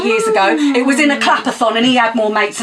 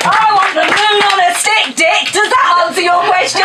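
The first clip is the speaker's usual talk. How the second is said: higher, louder triumphant finish; loud punchline; 8 semitones higher, 4 dB louder than usual. Acted. Poet landing her poem's punchline to the audience, loud and triumphant. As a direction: slam the last line home.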